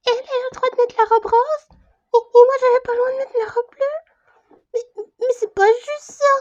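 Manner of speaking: high, wailing complaint on the verge of crying; high-pitched sobbing grievance; tearful whining. Acted. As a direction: protest like a hurt child, pitched high. Whining that it is unfair.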